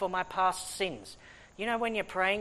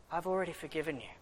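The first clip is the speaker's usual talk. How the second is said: softer, quick reassuring; gentle reassurance; 3 semitones lower, 7 dB quieter, faster than usual. Retold or imitated. God quietly reassuring the preacher he is forgiven, softly. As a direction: say it softly, settled. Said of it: "and God says".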